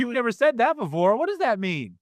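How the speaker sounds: high-pitched, wondering parent; acted puzzled curiosity. Parent asking a child about a new word, puzzled. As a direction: ask it high, playing surprised and curious.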